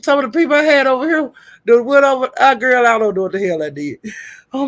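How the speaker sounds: usual speaking voice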